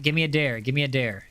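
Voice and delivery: ordinary delivery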